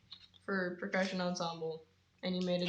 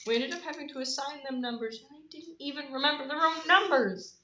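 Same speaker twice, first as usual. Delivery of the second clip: higher voice rising to loud exasperation; exasperated complaint; 6 semitones higher, 6 dB louder than usual. Real, not acted. Venting about freshmen forgetting their numbers, rising exasperation. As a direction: build up to an indignant finish.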